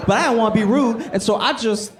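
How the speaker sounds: normal talking voice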